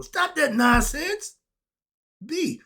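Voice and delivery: slow, loud scolding of the audience; stern rebuke. Real, not acted. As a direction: scold them sharply. Scolding complacent listeners.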